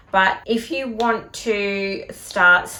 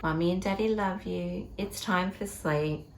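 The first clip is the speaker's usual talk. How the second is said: singsong bedtime voice; 5 dB quieter than usual. Shown as a demonstration. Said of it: "say it in that really na, na, na voice".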